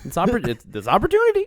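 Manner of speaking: high, exaggerated encouragement; hammed-up high teacher voice; very encouraging teacher. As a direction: imitate a teacher cheering on a small child. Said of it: "being very encouraging to [name]".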